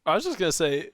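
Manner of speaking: normal talking voice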